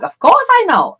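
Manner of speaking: sudden high, loud defensive snap; a hammed-up huffy comeback; mock indignant retort. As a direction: play the offended partner firing back. Imagined partner snaps back.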